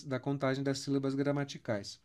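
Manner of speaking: ordinary delivery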